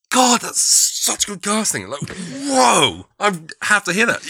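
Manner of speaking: high, loud gush of excitement; thrilled outburst, drawing out the wow; amazed fan reaction. Acted. Radio fan gushing over surprise casting, amazed and excited.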